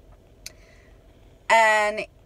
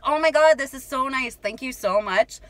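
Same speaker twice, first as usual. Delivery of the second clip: mock gushing; 5 semitones higher than usual. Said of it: "So I was like".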